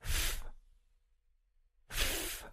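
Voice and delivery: "F" as soft, isolated breath, no voice; voiceless final sound. Shown as a demonstration. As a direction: model a hissed "F", pause, repeat it. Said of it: "start with the last sound in thief".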